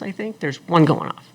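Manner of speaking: ordinary talk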